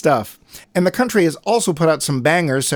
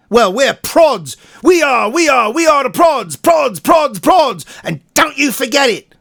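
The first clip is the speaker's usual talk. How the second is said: loud, high cockney geezer banter; high, loud cockney bloke voice; mock London geezer accent; 6 semitones higher, 3 dB louder than usual. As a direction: ham up a brash London lad. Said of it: "I'm gonna drop this accent".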